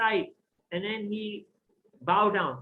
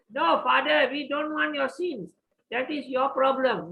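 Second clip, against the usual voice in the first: put-on children refusing; 6 semitones higher, 5 dB louder than usual. Children refuse to share sins.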